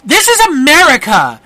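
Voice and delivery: high, loud, indignant protest; outraged woman. Acted. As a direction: complain shrilly like an offended citizen. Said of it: "and she's like".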